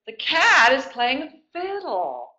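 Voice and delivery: usual speaking voice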